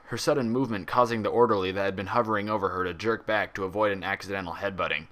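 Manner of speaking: ordinary delivery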